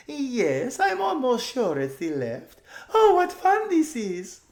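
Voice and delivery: very high, loud, slow giddy voice; a giggly rich girl thrilled by the adventure; silly excited girl. Acted.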